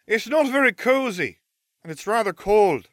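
put-on very high, airy-light girl's character voice at normal volume; Luna calling out. A narrator's reading. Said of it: "Luna called from my trunk".

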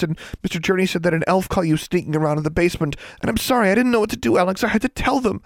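put-on high, fast, breathless girl's babble; frantic burbling. A narrator's reading. Anxious friend babbles the whole story to the dazed girl, high and fast. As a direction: pour it all out in a panicked rush. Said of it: "Anna's frantic burbling".

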